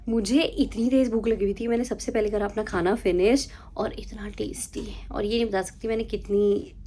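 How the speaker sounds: conspiratorial whisper about her food. Acted. Whispering to viewers about how much she ate. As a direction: hush it like a secret.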